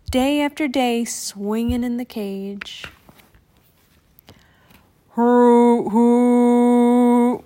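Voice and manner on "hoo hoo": long, louder hooting horn; ship's horn hoo. A narrator's reading. Narrator imitating the ship's horn as it arrives. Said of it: "went the ship's horn".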